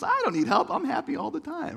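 high, loud, carefree brush-off; breezy optimist. Retold or imitated. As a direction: play your old sure-of-yourself self. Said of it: "I was an eternal optimist".

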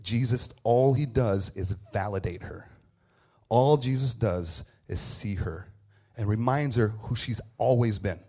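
ordinary delivery

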